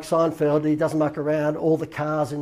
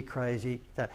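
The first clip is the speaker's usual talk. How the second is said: crazy dropped low and much softer; softer echo; 3 semitones lower, 9 dB quieter, slower than usual. Shown as a demonstration. Echoing his own emphatic word, quieter crazy. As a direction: let the second crazy fall away softer. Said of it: "We're talking, like, dumb, nu-, like".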